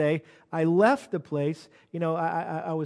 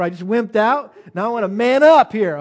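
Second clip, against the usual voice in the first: loud, higher, punchy self pep talk; rally yourself, hitting it loud; psyching himself up; 3 semitones higher, 10 dB louder than usual. Retold or imitated. Pastor pushing himself to take another chance, fired up.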